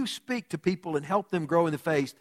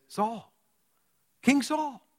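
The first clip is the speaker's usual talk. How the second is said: calling out; 7 semitones higher, much slower than usual. Acted. David calls after Saul from afar.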